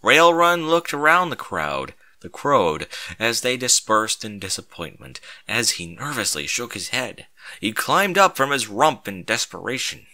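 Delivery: usual speaking voice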